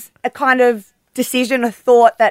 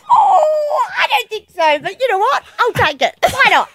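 flattered; 11 semitones higher than usual. Real, not acted. Guest reacts to gushing compliment.

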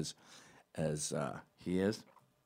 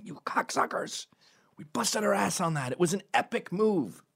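high, strained half-whispered outrage; indignant terrorist; 10 semitones higher than usual. Acted. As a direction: protest in a high, squeezed mock yell. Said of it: "the fucking terrorists would be going".

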